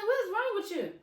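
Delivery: high, loud disbelief; baffled, loud scolding; exasperated. Retold or imitated. Acts her exasperated reply.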